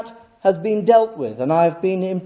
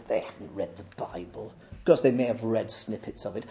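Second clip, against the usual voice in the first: much lower, very soft aside; hushed aside; 9 semitones lower, 11 dB quieter than usual. Real, not acted. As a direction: drop right down for a sceptical aside. Sceptical aside after mimicked boast.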